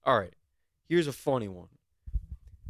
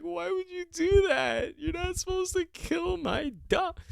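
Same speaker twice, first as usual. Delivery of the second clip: much higher, slow, whiny mock sobbing; mock crying owner. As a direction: wail it like a crying dog owner, hammed up. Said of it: "The guy's crying".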